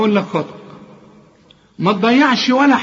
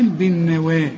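ordinary talk, nothing marked

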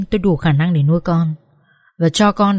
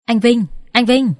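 panicked calling, pitched higher; calling frantically; 4 semitones higher than usual. A narrator's reading. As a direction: shake him and call his name in panic. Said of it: "lai lai Vinh và gọi". (gọi tên hoảng hốt, giọng cao)

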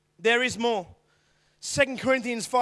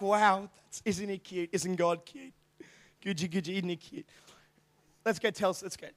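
put-on soft, lower cooing over a cute baby; softer, lower baby talk, lightly mocking; mock cooing angels; 6 semitones lower, 8 dB quieter than usual. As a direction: coo like a doting onlooker, softly. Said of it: "I don't think it's like".

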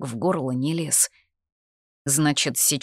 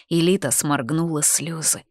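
ordinary delivery, nothing marked

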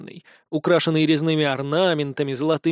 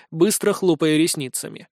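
ordinary delivery

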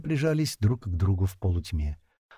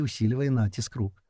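usual speaking voice

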